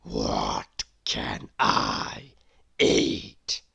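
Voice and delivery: very low, very slow rumbling growl; growling lion. A narrator's reading. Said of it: "growled the lion".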